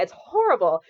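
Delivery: ordinary talk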